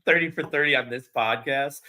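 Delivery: usual speaking voice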